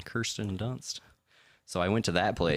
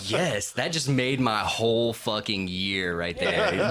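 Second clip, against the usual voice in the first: loud, drawn-out victory cry, half laughing; triumphant; 2 semitones higher, 5 dB louder, much slower than usual. Retold or imitated. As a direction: stretch out a triumphant yes. Retold triumphant reaction.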